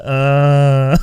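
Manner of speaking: one loud flat "Uh"; a bored British grunt, pushed out loud; loud deadpan British grunt; mock British grunt. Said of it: "British people are just like".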